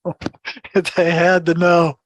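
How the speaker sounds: slow, laughing echo of his own words; laughing repeat. Real, not acted. To the host, laughing at his own need to know. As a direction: laugh it out slowly.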